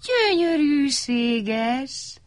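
very slow, high and dreamy; a hushed, admiring sigh of beauty; marvelling. A narrator's reading. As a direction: savour the word in wonder. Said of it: "mondták mindannyian".

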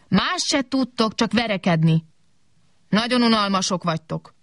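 mild scolding, pitch starting high. A narrator's reading. Kobak to the fighting robots, mildly scolding. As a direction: tell them off, exasperated. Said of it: "szólt rájuk Kobak".

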